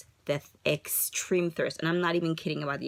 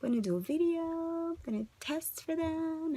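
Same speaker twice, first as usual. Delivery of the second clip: mock excited; 11 semitones higher, 4 dB quieter than usual. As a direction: replay the hopeful plan lightly. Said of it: "I was planning this".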